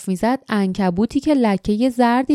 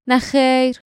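sharp, higher curt refusal; snapping; 4 semitones higher, slower than usual. Acted. Jasmine snaps a curt refusal at Jinks. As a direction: bite the answer off. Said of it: "جاسمین پرخاش کرد".

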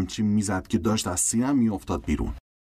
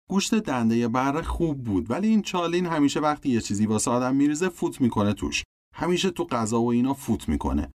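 ordinary delivery, nothing marked

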